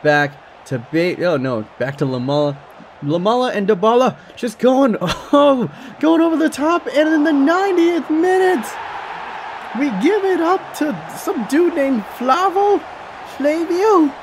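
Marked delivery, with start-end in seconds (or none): 3.0-14.1 excited yelling. Real, not acted. Late goal conceded, excited commentary.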